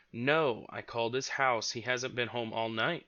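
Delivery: ordinary delivery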